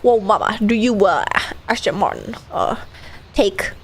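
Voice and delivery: low, halting mock wedding officiant. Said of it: "He's gonna be like".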